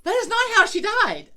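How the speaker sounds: high, loud disbelief; husband arguing the grandma story; incredulous husband. Retold or imitated. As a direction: bark it back in disbelief. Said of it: "And he goes".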